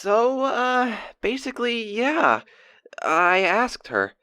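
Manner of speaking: high, halting, giddy confession; bashful excitement. Acted. Diarist confiding he asked her out, bashfully excited. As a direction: build up to it shyly in a high voice.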